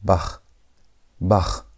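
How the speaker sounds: "Bach" ending in a German throat CH. Shown as a demonstration. Teacher repeats the German word, guttural CH. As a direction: finish "Bach" on friction, not a K stop. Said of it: "the German word Bach".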